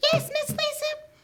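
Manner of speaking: very high, loud puppet voice; squeaky puppet. Pretend. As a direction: play the eager little puppet pal. Puppet Whiskers speaks.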